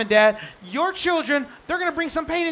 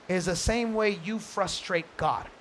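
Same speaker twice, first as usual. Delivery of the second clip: ordinary talk, nothing marked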